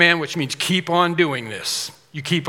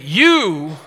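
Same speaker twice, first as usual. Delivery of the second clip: You called out loud with a laugh; emphatic You; 2 semitones higher, 8 dB louder than usual. Retold or imitated. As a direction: point right at them and hit You. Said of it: "It's like saying".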